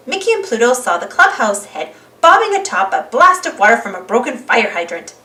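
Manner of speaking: plain reading voice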